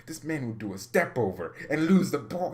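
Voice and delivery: ordinary talk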